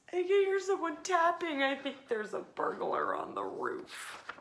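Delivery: scared whisper-ish worry about a burglar; frightened little mouse. A narrator's reading. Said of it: "called Little Mouse".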